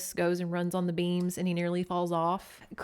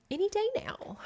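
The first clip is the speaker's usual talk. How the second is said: hushed, voiceless, higher whisper; 13 semitones higher than usual. Pretend. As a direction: breathe it like a secret hope. Repeats phrase as hushed hope.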